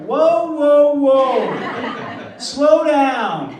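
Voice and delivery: loud, high mock Texan drawl, laughing; Texan telling him to slow down. Texan man tells the fast-talking Aussie to slow down, loud and high. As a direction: play the Texan waving him down, hammed up. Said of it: "And he went".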